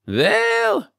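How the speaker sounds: put-on high old rabbi, Yiddish accent. Rabbi answers crowd.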